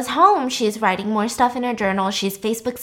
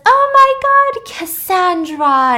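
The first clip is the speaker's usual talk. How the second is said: squealed, much higher and louder, drawn out; mock excited greeting; 10 semitones higher, 9 dB louder, slower than usual. [name] greets her old friend with a high squeal. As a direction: gush over a long-lost friend.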